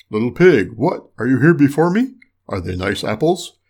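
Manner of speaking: wolf caught off guard, forceful; surprised wolf. A narrator's reading. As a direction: call up to the pig as the storybook wolf. Wolf finds pig in tree.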